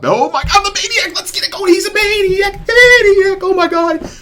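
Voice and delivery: high, loud hyped-up outburst; real excitement. Real, not acted. Gamer getting the Maniac streak, wildly excited. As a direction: explode with excitement, pitch shooting right up. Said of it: "I'm just getting excited".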